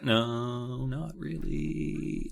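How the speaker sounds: soft and slow, sheepish; sheepish mumble. Retold or imitated. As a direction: mumble it guiltily. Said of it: "He's like".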